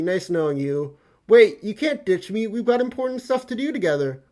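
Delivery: normal talking voice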